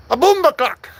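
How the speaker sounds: loud mock Jamaican accent. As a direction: try a punchy Jamaican exclamation. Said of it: "do a Jamaican accent".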